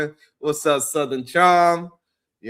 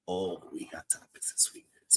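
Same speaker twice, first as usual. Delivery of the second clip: whisper; 7 semitones lower, 17 dB quieter than usual. Real, not acted. Teasing hushed aside to viewers.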